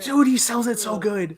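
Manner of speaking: high-pitched fan rave; excited gushing. Real, not acted. Fan raving to friends about an actor, excited gushing. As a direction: gush in real awe of the performance.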